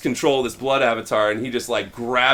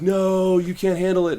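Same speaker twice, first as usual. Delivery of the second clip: alarmed warning; 7 semitones higher than usual. Retold or imitated. Will warns Rowan.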